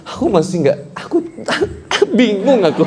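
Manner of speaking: high, broken-up acted whimpering; mock distraught wife. Wife too confused to sit down, high hammed-up whimper. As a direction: stammer it high, as if close to tears.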